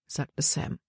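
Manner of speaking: ordinary delivery, nothing marked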